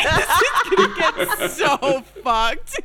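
slow, laughing tease; giggling warning. Real, not acted.

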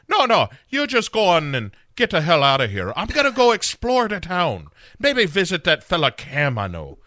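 high, loud Irish beat-cop voice; hammed-up Irish accent. Sarah shooing her lover out, hammed-up Irish accent. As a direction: push it high with a comic Irish lilt. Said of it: "slight bit of the Irish beat cop".

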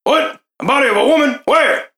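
high, loud startled questions; alarmed surprise. Acted. Guard hears of a woman's body, alarmed. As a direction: fire back questions in alarm.

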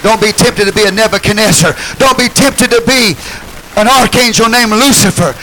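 loud, high preaching build; fiery pulpit preaching; full-force preaching. Real, not acted. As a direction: preach it out to the congregation.

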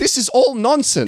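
very high, incredulous outburst; exasperated. Real, not acted. Co-host fed up with the film's plot, exasperated high pitch. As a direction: protest at the absurdity in a high voice.

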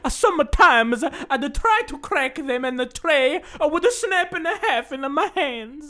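put-on Italian accent. Reading the ice tray review in an Italian accent. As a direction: keep going as an old Italian man. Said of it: "very impressive Italian accent".

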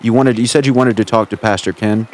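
ordinary delivery

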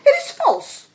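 very high, loud protest; cried denial. A narrator's reading. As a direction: squeal out a desperate denial. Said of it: "cried the minister's son".

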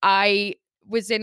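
ordinary talk, nothing marked

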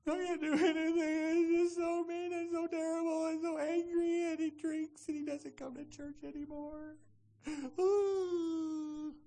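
high, whining mock wail; hammed-up tearful complaint on one high note; wailing passive victim. Victim complaining about a husband, high exaggerated wailing. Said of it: "that passive victim".

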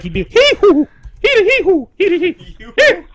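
high squealing hee hoo noises; Tourette's tic imitation. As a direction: yelp out shrill hee hoo sounds. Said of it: "his tick would be like".

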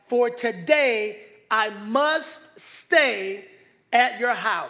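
must drawn out, very slow; stress on must. Shown as a demonstration. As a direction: lean hard on must. Preacher stresses Jesus' words.

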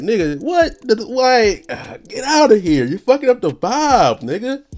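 high-pitched disbelieving rant; exasperated outburst. Pretend. Telling Birdman off in his head, high incredulous rant. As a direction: act out being fed up, voice climbing high.

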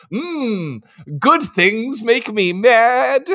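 high, slow hammed-up cartoon villain growl; Skeletor-like villain. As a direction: ham it up like a petty cartoon baddie who hates joy. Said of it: "she's just like".

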